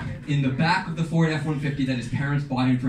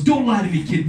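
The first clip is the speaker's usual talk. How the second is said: higher, louder mock tough cop; stern cop; 5 semitones higher, 4 dB louder than usual. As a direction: lay down the law, hammed-up. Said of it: "And he goes".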